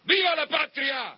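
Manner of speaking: high, loud rallying cry; patriotic shout. Real, not acted. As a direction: shout it out to the crowd. Grito patriótico ante el público. (grito patriótico a todo pulmón)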